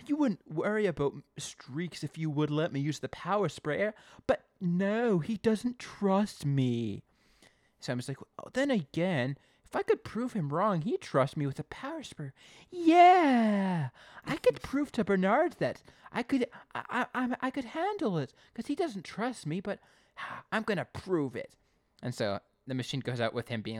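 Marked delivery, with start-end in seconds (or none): 0.1-7.0 high, slow, hammed-up self-pity; mock sulk, stretching the final words; sulky grumble. Acting Simon grumbling alone. 8.4-21.6 high, loud, hammed-up brainwave; mock excitement settling into determination; building resolve. Acting Simon talking himself into it.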